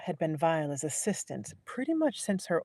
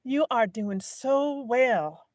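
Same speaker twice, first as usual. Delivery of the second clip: slow, high gushing praise; exclaimed; 7 semitones higher, 4 dB louder, much slower than usual. Acted. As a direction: draw out the praise brightly. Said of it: "Viola exclaimed".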